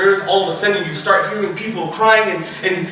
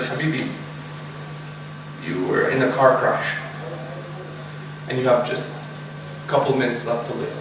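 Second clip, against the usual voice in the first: low, hushed, halting delivery; grave, tender news; 7 semitones lower, 7 dB quieter than usual. Acted. Mother telling her child of the crash, grave and tender. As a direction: break it softly, pausing between phrases.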